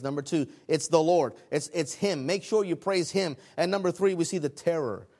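ordinary talk

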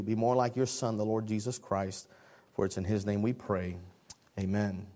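hushed, low public prayer; opening prayer. Real, not acted. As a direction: pray low and soft, in an earnest, even murmur.

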